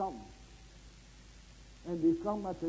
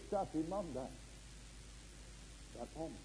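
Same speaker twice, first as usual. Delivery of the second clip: ordinary delivery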